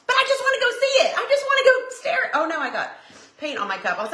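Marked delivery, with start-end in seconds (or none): 0.1-2.3 squeaky high pleading, fast and laughing; whiny plea. Retold or imitated. To husband on the couch, a squeaky laughing plea. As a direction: beg like an eager kid. Begging husband to see pool.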